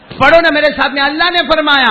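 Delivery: loud, high call to recite along; big preacher's announcement; rousing call. Real, not acted. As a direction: summon the congregation at full voice.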